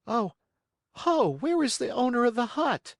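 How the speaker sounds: high, puzzled exclamation; surprised cry. Acted. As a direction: wonder where the owner is. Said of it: "cried Pinocchio, very much surprised".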